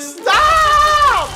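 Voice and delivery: sudden high shout. Real, not acted. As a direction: yell it out. Shouts at laughing friend.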